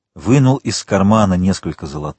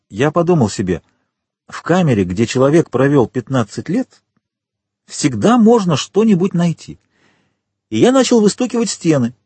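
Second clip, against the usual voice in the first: higher-pitched, eager storyteller; gatekeeper telling his story; 5 semitones higher than usual. A narrator's reading. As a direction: voice the gatekeeper, pitched up and rising as the tale builds.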